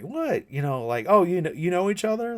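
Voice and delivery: high, fast, startled what; shocked disbelief. Retold or imitated. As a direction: jolt up in alarmed surprise. Sailor shocked to see Perdita.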